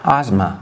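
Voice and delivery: "آزما" with a voiced z sound; pronounced with a z. Shown as a demonstration. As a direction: model "آزما" alone, clearly voicing the z.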